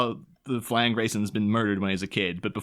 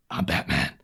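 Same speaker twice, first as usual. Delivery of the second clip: lower, growled I'm Batman; gruff Batman; 8 semitones lower than usual. Retold or imitated. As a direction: drop into a deadpan hero voice. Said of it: "the guy's just like".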